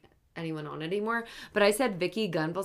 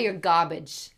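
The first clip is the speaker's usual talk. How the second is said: louder Jersey accent, imitating Caroline; 7 dB louder, slower than usual. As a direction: spit it out like a New Jersey housewife. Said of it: "that's a good accent".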